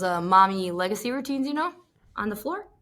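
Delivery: ordinary talk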